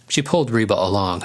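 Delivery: ordinary delivery, nothing marked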